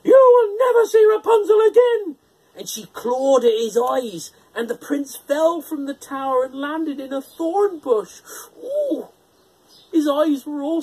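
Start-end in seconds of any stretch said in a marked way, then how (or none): 0.0-2.2 high, very loud furious witch scream. A narrator's reading. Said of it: "the witch screamed at him". 8.6-9.1 very high sympathetic ooh; wincing ooh. A narrator's reading. Prince falling into thorns.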